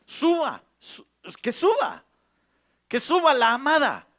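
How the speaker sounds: high, loud, stretched call with a sob in it; crying out. Acted. Preacher crying to the congregation that the beloved rise, ringing call. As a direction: plead it out, summoning the beloved upward.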